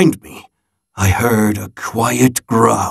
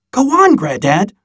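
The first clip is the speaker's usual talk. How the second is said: put-on higher, eager child's plea; a kid begging for more of the story; 7 semitones higher than usual. Child urges Granddad to continue.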